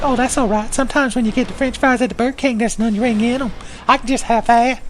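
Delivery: high-pitched ghost sidekick voice; Gower. Acted. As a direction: pitch it way up as an overfriendly neck-hugging ghost. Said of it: "He's like".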